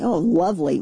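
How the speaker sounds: ordinary talk, nothing marked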